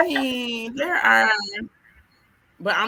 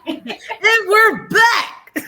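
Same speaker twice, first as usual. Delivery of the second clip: high, slow, drawn-out host voice; show host announcement; 13 semitones higher, 9 dB louder, much slower than usual. Acted. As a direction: stretch it like a TV host.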